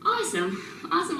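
ordinary talk, nothing marked

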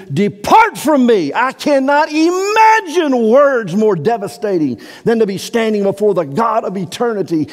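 high, loud pulpit preaching, pressing hard; fervent preacher at full cry; full-force preaching. Acted. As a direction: drive the scripture home from the pulpit.

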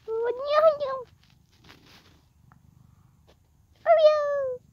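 high, loud call of the name. Real, not acted. Kid calling the cat back as she wanders off, loud and high.

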